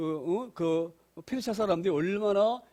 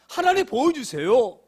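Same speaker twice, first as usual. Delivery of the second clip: higher, louder desperate plea; pleading cry; 5 semitones higher, 7 dB louder than usual. Acted. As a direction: cry out to God begging. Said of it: "시편 기자 심령과 똑같아요". (하나님께 간절히 부르짖는 외침)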